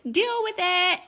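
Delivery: high, loud, defiant send-off; defiant shout. Real, not acted. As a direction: shout it as a final challenge. Defiant sign-off to listeners.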